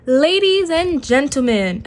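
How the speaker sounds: light ringmaster announcing; grand announcement. A narrator's reading. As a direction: announce it to the whole audience. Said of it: "announced the rabbit".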